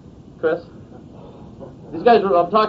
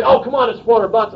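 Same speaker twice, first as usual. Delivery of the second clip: louder, higher exasperated sales push; pushy pressure; 4 semitones higher, 6 dB louder than usual. Acted. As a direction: pressure them as if it's nothing. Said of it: "when people pressure me".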